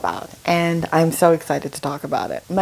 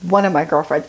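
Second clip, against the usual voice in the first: normal talking voice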